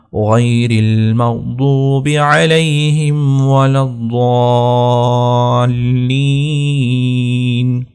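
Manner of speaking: slow melodic tilawat; slow, chanted Quran recitation. Acted. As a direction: recite slowly with drawn-out vowels. Recites full Fatiha.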